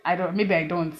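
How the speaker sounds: ordinary delivery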